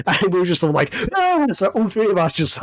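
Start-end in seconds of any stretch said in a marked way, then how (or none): 1.2-1.5 short high mock scream. Friends startled by the bear behind them, short high mock scream. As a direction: yelp in fright as the bear jumps out.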